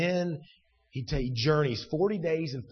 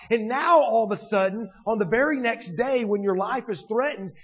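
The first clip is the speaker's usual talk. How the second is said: high, insistent, building interrogation; challenging questioning; 7 semitones higher, 4 dB louder than usual. Acted. Preacher as God confronting Elijah, challenging.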